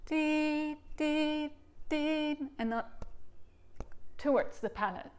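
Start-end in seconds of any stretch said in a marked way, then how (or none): 0.1-2.4 "dee" sung high with the tongue kept back; dee syllables. Shown as a demonstration. Teacher showing students backward tongue placement with a high "dee". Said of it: "So always think backwards".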